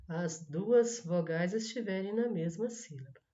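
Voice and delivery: usual speaking voice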